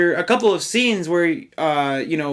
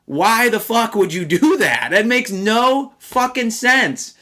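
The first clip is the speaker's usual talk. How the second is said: exasperated outburst; 6 semitones higher, slower than usual. Real, not acted. Exasperated rant at villain plot.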